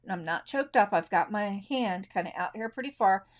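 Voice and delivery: ordinary delivery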